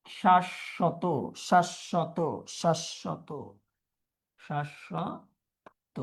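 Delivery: শাশ্বত said again and again, slowing and fading; teacher drilling শাশ্বত; repeated slow pronunciation. Shown as a demonstration. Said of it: "দেখো, আমরা লিখি".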